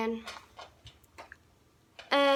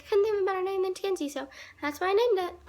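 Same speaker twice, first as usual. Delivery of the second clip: put-on quoting the boy; 7 semitones higher, faster than usual. Boy's quoted line, fast and high.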